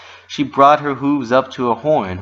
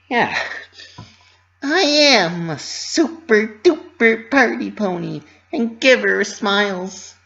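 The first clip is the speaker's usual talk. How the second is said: proud Pinkie; 11 semitones higher, 4 dB louder than usual. Acted. Pinky reminding herself she gives smiles, high proud voice. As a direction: puff yourself up, high and deliberate.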